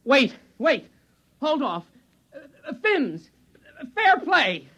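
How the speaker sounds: high, rushed begging; a high scared plea for mercy; frantic pleading. Acted. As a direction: stall for time in panic. Begging the spear-holder.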